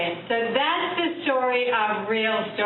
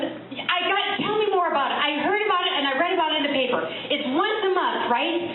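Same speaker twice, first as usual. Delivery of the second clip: excited; 8 semitones higher than usual. Retold or imitated. Eager fan gushing about the show.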